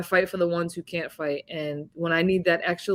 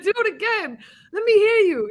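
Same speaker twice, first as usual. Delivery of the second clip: much higher, shouted coach's urging; coach urging louder; 13 semitones higher, 5 dB louder than usual. Retold or imitated. A coach shouts at his fighter to repeat her war cry, much higher. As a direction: yell at her to give it more. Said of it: "he said".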